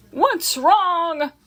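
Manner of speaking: sudden worry from the loudspeaker; startled call; alarmed announcer. A narrator's reading. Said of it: "asked the loudspeaker".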